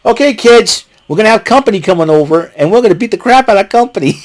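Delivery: mock dad announcement. Imagining Matt Hardy to family.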